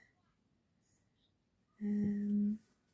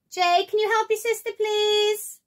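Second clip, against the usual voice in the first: raised parent call across the house; 13 semitones higher, 8 dB louder than usual. Real, not acted. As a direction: shout up to Jay to help. Calling son to help sister.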